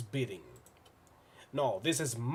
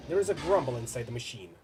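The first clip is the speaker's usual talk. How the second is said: usual speaking voice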